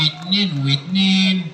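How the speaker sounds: slow, very low puppet voice; Aragoz puppet. Acted. Puppet telling the host his name, slow Aragoz voice. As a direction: draw it out as a puppet character. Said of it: "الأراجوز ده وهو بيرغي".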